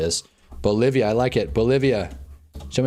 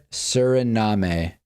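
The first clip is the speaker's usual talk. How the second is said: slow spelled-out; 2 semitones lower, much slower than usual. Real, not acted. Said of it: "Did I spell that right?".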